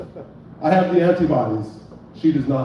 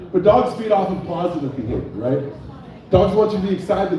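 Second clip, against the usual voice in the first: ordinary talk, nothing marked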